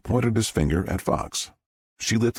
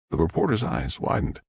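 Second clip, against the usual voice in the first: plain reading voice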